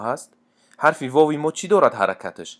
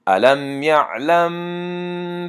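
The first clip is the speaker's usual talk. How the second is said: slow, drawn-out chanted recitation, higher and louder; Quran recitation; 6 semitones higher, 4 dB louder, much slower than usual. Shown as a demonstration. Teacher chanting verses for students, slow melodic recitation.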